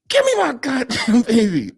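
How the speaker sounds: high-pitched imitation of an irate aunt; mock angry demand. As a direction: act out an indignant aunt, half laughing. Aunt demands her baby back.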